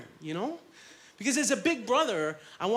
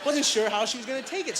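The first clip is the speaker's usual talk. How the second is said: ordinary delivery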